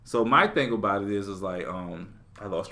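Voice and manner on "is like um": normal talking voice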